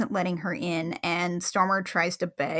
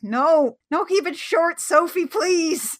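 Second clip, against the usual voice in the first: pleading; 7 semitones higher, 4 dB louder, slower than usual. Retold or imitated. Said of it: "Kelly was like".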